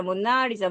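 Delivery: "모나리자"의 "나"에 강세; "모나리자"에서 "나"를 힘주어. Shown as a demonstration. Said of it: "모나리자 강세". ("모나리자"의 "나"를 세게)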